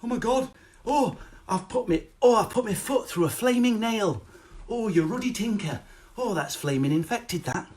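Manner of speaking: high, mild polite fretting; hammed-up genteel dismay; gentle father-in-law. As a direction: fuss mildly like a man who never swears. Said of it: "father-in-law never swears".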